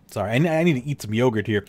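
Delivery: usual speaking voice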